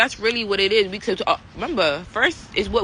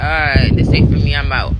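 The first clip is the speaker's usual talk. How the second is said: loud, lower, fed-up brush-off; frustrated brush-off; 4 semitones lower, 9 dB louder than usual. Retold or imitated. Leaving friends at a party, loud and frustrated. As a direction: storm out of a place that isn't your vibe. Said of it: "you're giving out frustration".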